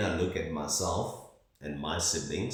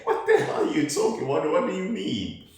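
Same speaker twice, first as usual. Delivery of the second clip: much higher, fast, laughing through the words; laughing disbelief; 12 semitones higher, 9 dB louder, faster than usual. Retold or imitated. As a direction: retell your baffled reply mid-laugh. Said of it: "And I was like".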